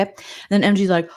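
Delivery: ordinary talk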